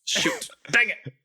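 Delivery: Carl voice. Acted. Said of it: "the voice of Carl go".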